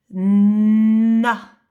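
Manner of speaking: Na said loud and clear; dictation. Shown as a demonstration. Teacher dictating a syllable to the child, loud and clear. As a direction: pronounce Na for dictation. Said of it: "tu écris na".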